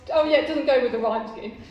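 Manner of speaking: ordinary delivery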